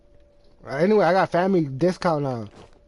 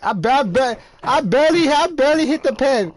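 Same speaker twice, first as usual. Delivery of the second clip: defensive; 6 semitones higher, 4 dB louder than usual. Real, not acted. Defending himself about vaping.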